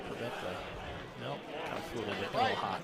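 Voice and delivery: golf commentator. Acted. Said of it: "Don't use the golf commentator voice".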